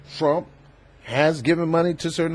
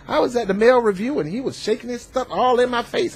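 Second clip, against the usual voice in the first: high-pitched mock female locker-room talk; imitated woman bragging; 8 semitones higher than usual. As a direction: put on a much higher voice, gossiping like one of the girls. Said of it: "Women talk like that, too".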